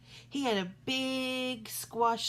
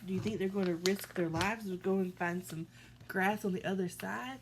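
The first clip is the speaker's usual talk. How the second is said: ordinary delivery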